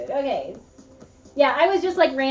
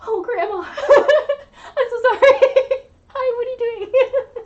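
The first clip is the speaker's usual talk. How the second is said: high-pitched, laughing retelling; retold laughing apology; 10 semitones higher, 6 dB louder than usual. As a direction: re-enact an embarrassed apology to grandma through giggles. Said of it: "and I'm just like".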